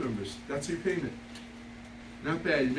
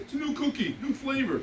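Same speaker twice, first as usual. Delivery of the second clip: higher-pitched take on a coaxing son; imitated kid; 8 semitones higher than usual. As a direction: mimic a kid selling a prank. Voices his kid selling the cookie.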